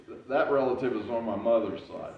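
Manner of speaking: ordinary delivery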